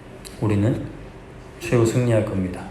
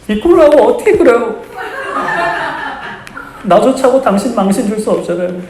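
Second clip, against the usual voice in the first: higher, louder mock heroine voice with a laugh; heroine's tearful plea; 11 semitones higher, 4 dB louder than usual. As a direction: play the humiliated heroine, half-joking. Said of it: "여주가 뭐라고요?".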